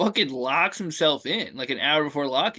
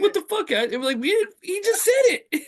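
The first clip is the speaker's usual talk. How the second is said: mock indignant protest; 3 dB louder than usual. Voices both sides of the protest.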